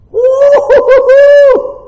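long, high, loud whoop; celebratory holler; jubilant whoop. Real, not acted. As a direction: whoop it out with joy. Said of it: "That's a little bit of that shout".

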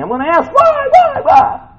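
very high, loud, rising plea; demanding why. Retold or imitated. Preacher imitating people vowing to question God, shrill pleading whys. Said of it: "I've had people tell me".

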